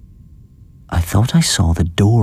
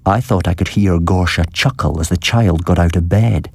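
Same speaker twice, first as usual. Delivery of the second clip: ordinary delivery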